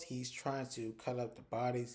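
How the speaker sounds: ordinary delivery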